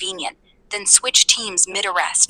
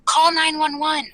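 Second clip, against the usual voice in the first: mock hollering; 7 semitones higher than usual. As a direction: mildly imitate someone hollering for help. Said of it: "Yet she's hollering".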